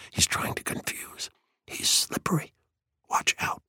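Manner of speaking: urgent hushed warning; whisper. A narrator's reading. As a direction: whisper secretly into his ear. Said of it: "whispered Faber".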